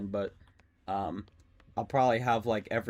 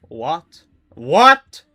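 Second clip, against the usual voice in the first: high, loud disbelieving what; shocked yell; 13 semitones higher, 17 dB louder than usual. Real, not acted. Streamer yelling at the killer's weird move. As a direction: blurt it out in total shock.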